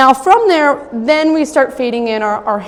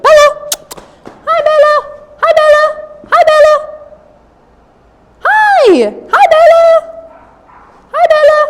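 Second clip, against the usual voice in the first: very high, loud sing-song hello to the dog; high puppy greeting; 7 dB louder than usual. Real, not acted.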